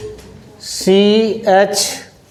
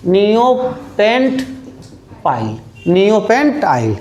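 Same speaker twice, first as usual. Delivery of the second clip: slow spelled-out naming; much slower than usual. Shown as a demonstration. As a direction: draw out नियोपेंट pile very slowly, syllable by syllable. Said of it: "तो इसको बोलेंगे neo". (Neopentyl को धीरे-धीरे खींचकर बोलना)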